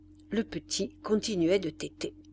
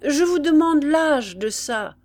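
loud, higher repeated demand; insistent; 7 semitones higher, 8 dB louder than usual. A narrator's reading. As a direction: press her firmly for an answer. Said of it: "la vivandière insista". (ton insistant)

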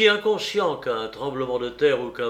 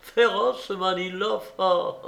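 high, protesting echo; incredulous exclamation; 6 semitones higher than usual. Acted. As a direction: exclaim at so absurd an idea. Indignant echo of his word. (exclamation incrédule)

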